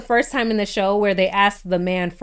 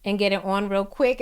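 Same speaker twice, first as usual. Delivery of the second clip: sly hush; 6 dB quieter than usual. Retold or imitated. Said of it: "Tiffany tells Brett".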